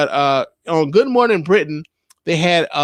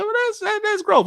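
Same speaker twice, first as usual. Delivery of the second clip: pitched way up, stressing that's growth; high emphatic. Real, not acted. Praising Harry, voice jumps way up. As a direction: squeak it out, way up high. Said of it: "emphatic repeat of growth".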